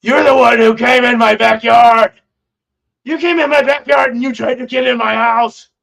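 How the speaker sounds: put-on loud, indignant old man shouting the accusation; angry old man accusing. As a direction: yell it like a furious neighbour catching a trespasser. Old man confronting the speaker.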